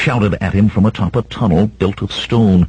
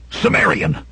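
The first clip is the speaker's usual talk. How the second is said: shouted; 12 semitones higher than usual. A narrator's reading. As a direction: call out to him in a much higher voice. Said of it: "Then Eldron shouted".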